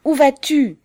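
high, loud angry yell; yelled demand. A narrator's reading. Suitor to the girl escaping his grip, yelling. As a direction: yell it after someone trying to get away. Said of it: "hurla-t-il".

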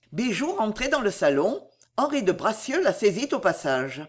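usual speaking voice

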